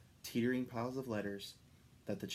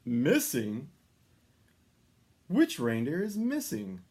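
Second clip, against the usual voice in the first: surprised Santa; 3 semitones higher, 7 dB louder, slower than usual. A narrator's reading. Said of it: "asked Santa".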